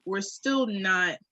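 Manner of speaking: normal talking voice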